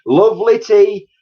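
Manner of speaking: high, loud sly glee; gleeful. A narrator's reading. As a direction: tempt her sweetly. Mister Cranky gleeful.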